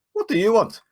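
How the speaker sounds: high, loud, confrontational demand; challenging character voice in Goblin. Acted. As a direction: square up to a goblin. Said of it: "as he replies in Goblin".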